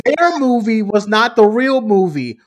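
loud, high, laughing disbelief; laughing outrage. Real, not acted. Outraged at the rumor claims, loud laughing disbelief.

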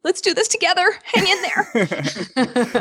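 high, over-bright encouragement, drawn out at the end; mock pep talk. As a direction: play-act a plucky rallying cry. Mock pep talk about coping.

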